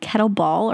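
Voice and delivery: "kettlebell" ending in "ball"; says "kettle ball". Shown as a demonstration. Asking friend which name is right, "kettlebell" said as "kettle ball". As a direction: say kettlebell with an "aw" in the last syllable. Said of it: "What is it? Is it called".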